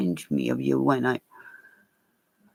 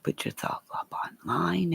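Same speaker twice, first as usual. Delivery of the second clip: whispered quote; 4 semitones higher, 6 dB quieter than usual. Retold or imitated. Quoting what people told her.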